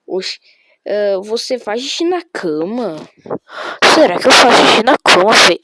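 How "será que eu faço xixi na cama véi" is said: mock indignant. Real, not acted. Mocking a viewer's question.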